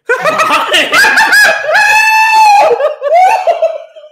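high shrieking laugh; loud laugh. Real, not acted.

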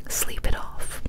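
plain reading voice

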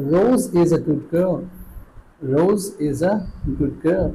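every word stretched out slowly; slow classroom demo; very slow model sentence. Shown as a demonstration. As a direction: spell it out slowly for learners. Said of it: "teacher models sentence slowly".

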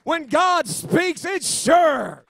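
high, shouted revival preaching; soaring preacher's climax at full volume; full-force preaching. Real, not acted. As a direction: shout it out in ecstatic proclamation.

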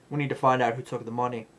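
put-on flat version with the Southern accent gone; accent dropped. Mocking the cop losing her accent, plain fast delivery. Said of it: "the next minute she's like".

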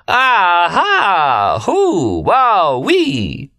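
high, drawn-out laughing whoops; whooping laughter. A narrator's reading. Remy laughing till red as a beet, high spelt-out whoops. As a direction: whoop each syllable out long and high.

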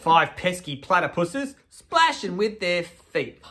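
slow, lilting storybook read; reading to children. Acted. Hosts reading to kids at home, storybook voice.

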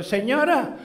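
high voice, asking across the counter; calling to shopkeeper. Retold or imitated. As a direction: act out calling for the lady's attention. Gomero addresses old shopkeeper.

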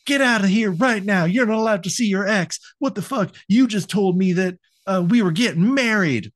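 mock tantrum. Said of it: "bitching at him and going crazy".

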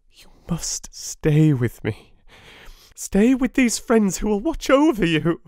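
high, loud frantic plea; cling to him with your voice; crying out frantically. A narrator's reading. Wife begging her husband not to leave her, frantic. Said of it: "cried out".

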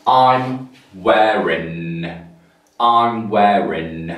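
"wearing" very slow, ending on n. Shown as a demonstration. Teacher drilling "I'm wearing" slowly. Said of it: "so finishes with the N sound".

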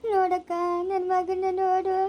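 slow, tearful plea; grief-stricken, drawn-out lament; crying. Acted. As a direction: wail it out as a bereaved mother. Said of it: "ಅಳ್ಬೇಡ".

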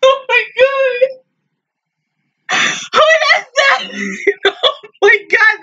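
high, tearful wail; real crying. Real, not acted. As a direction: wail through real sobs. Overwhelmed, crying after episode ends.

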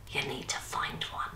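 very soft, gleeful whisper. A narrator's reading. Nan tells Vi to find a mission, whispering gleefully. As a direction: whisper it like a delicious secret. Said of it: "Nan whispered gleefully".

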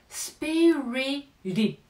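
"スピリティッド" with the t flapped like a Japanese ら-row r. Shown as a demonstration. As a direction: say "スピリティッド" as "spiriridd", tongue tapping once. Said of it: "ラ行の音になってしまう".

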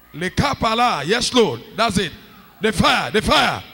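loud, insistent calls for fire; fervent preaching. Real, not acted. Preacher calling down fire on praying congregation, fervent loud urging.

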